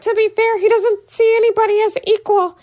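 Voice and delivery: high, put-on mocking voice of defensive fans. Mocking online fans defending McGavin in a high put-on voice. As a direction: squeak it out like a smug fan. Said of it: "And people will be like".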